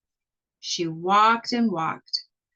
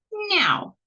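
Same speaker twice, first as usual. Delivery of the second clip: high, pointed witchy "Now"; sharp witch command; 13 semitones higher than usual. Acted. The witch orders Sasha, high and sharp. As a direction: snap it like a bossy witch.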